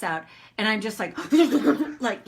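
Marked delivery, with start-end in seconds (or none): 1.2-2.0 burst of laughter. Real, not acted. Laughing at herself.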